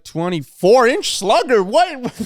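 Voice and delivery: high, slow, loud mock astonishment; mock shocked [name]. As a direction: play a stunned pop star.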